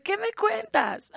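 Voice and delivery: slow, high, airy chit-chat; relaxed new mother imitated casually; breezy spa lady. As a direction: play it like you just left the spa. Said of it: "Le faltaba nada más el café".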